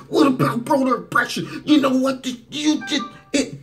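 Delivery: high-pitched parody of a heckling reporter; mocked pushy reporter. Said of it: "Or is it her like".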